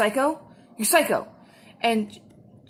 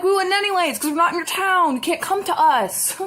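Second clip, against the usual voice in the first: high, emphatic comeback; exasperated retort; 7 semitones higher, 3 dB louder than usual. Retold or imitated. Worker firing back at rude caller, high and exasperated. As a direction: snap back at the caller, pitched up.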